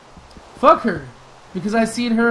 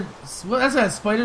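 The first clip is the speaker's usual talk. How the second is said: normal talking voice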